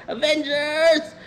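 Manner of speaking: high, slow stretched-out call to the team; rallying battle cry. Retold or imitated. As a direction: draw it out like a hero summoning everyone. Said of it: "Sabi niya".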